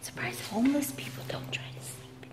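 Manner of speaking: hushed whisper. Real, not acted. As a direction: whisper so nobody hears. Sneaking into empty house.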